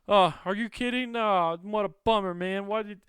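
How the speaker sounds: mock casual prisoner shrugging off the news; faking cool. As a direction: act totally unbothered, chirpy and high. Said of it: "trying to play it real cool".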